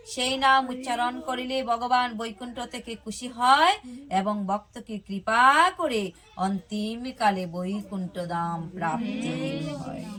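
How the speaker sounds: ordinary delivery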